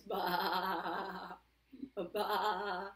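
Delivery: wobbling, drawn-out baa; sheep bleat. Pretend. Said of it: "And the sheep says".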